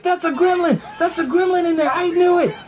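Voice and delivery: high-pitched hollering; mock panicked shout. Retelling the neighbor spotting a gremlin, high mock shout. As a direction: imitate a man crying out in alarm. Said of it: "Then he's like".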